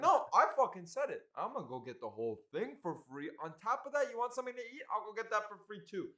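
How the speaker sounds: imitating a stubborn dad, mostly pitched high; loud outburst first, then high, cocky insistence; defiant insistence. Son acting out dad insisting on freebies, defiant and high.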